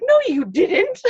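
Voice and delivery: high, loud, scoffing no; flat disbelief. Pretend. To someone claiming a skateboarding accident, high loud scoff. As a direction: call out an obvious lie. Imagined reply to obvious lie.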